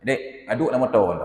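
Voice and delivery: a touch of a stern scolding. Retold or imitated. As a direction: tell the kid off, firm but not shouting. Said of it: "dengan suara agak sedikit keras intonasinya".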